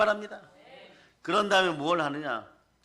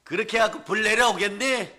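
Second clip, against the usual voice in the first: mocking taunt; 4 semitones higher, 6 dB louder than usual. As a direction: taunt them to shout louder. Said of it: "조롱하면서 이, 이렇게 얘기해요". (조롱하듯 크게 외치는 말투)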